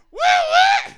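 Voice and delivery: high, loud owl-like woo call; lookout call. Acted. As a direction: shout a silly bird call to warn the group. Practising his lookout call.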